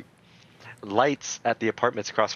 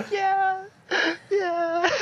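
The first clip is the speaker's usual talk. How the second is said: put-on much higher squeaky kid agreeing; high toddler voice. Imitating the nephew promising to be good, high squeak. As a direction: squeak a tiny scared yes.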